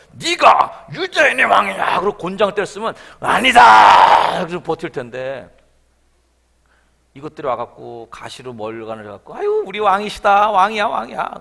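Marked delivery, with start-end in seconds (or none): 0.1-2.0 much louder, slow, weighty "네가 유대인의 왕이냐?"; booming slow demand. Retold or imitated. Soldier beating Jesus, booming slow interrogation. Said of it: "차라리 빠따를 치면서". 3.2-4.4 huge drawn-out shout; defiant shout. Pretend. Imagined Jesus under the rod, defiant roaring shout. 9.4-11.3 louder, sarcastic cheering; mock homage. Soldiers mocking Jesus in a crown of thorns, loud sarcastic jeering. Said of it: "침을 뱉으면서".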